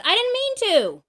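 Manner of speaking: high, loud mock whine of a kid; defensive kid. Mom imitating kids quick to excuse themselves with a loud high whine. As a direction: whine it like a child dodging blame. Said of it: "first thing out of their mouths is".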